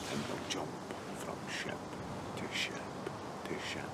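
low, hushed, sinking into a whisper; fading to whisper. Acted. Ending his loyalty lecture to his mate, hushed to a whisper. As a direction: let each phrase drop away, ending whispered.

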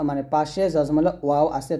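normal talking voice

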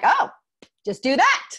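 high, loud, cheerful mock of men who get it at once; breezy guy. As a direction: toss it off like an eager guy who's sold. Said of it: "They're like".

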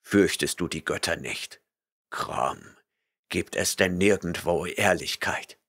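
stern barbarian rebuking a liar; gruff barbarian. A narrator's reading. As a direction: rumble your disgust. Conan's voice.

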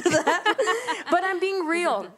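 laughing. Real, not acted. Laughing about dancing at home.

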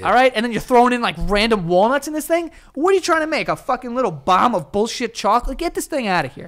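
high, heated incredulous rant; mock outrage. Co-host ranting about walnuts in chocolates, mock outrage.

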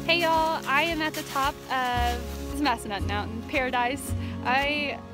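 ordinary delivery

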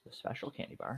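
hushed, secretive whisper. Pretend. Joking about special candy bars.

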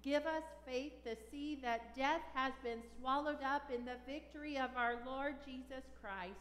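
ordinary talk

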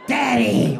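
loud shout of Daddy; hammed-up daughter yell; calling out. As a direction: yell for dad across the house.

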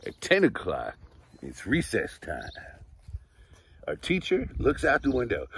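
lively, bright read-aloud storytelling; reading to children. Acted. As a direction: read it like story time for little kids. Reads picture book aloud.

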